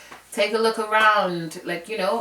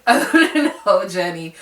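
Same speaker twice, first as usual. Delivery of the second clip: laughing; 5 dB louder than usual. Real, not acted. Repeats it laughing.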